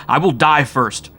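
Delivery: swearing it in panic; frantic. A narrator's reading. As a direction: cries out in terror. Continuing frantic cry.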